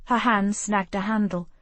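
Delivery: usual speaking voice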